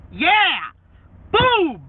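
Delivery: high loud victorious yell; triumphant shout. Real, not acted. Triumphant shout after song.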